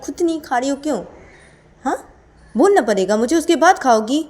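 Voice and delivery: loud, high indignant outburst; acted angry yelling. Character yells at partner for not eating without being told, angrily. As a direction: yell it as an accusing demand. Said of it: "तुम चिल्ला रहे हो मेरे पर".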